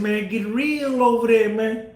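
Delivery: Southern US accent, mildly imitated. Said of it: "that down south accent".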